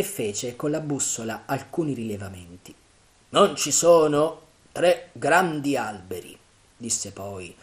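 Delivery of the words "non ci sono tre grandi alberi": slow, louder character voice; measured, raised pirate voice; pirate chief. A narrator's reading. Silver pointing out landmarks to his men, lightly voiced pirate chief.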